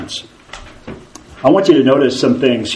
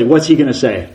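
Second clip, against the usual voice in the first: ordinary talk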